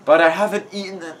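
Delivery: slow, high complaining groan; hammed-up groaning protest; Riley. A narrator's reading. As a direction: whine it, stretching the words out. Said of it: "Riley groaned again".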